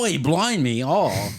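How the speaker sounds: high, loud mock Cockney British accent. Teasing a friend's attempt with a mock British accent. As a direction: ham up a bad British accent. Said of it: "You're just like".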